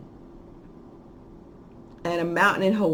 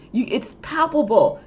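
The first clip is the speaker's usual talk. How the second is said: ordinary talk, nothing marked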